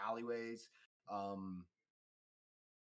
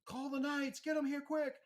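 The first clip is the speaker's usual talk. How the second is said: high, loud put-on bystander cry; urgent crowd call; 7 dB louder than usual. Bystander calling for knights to come, high urgent shout. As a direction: cry out for help in a shrieky high voice. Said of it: "you hear some calls for the".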